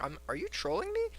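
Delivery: high squeaky, hesitant question; mock disbelief in a very high voice; incredulous. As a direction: rise into falsetto, baffled.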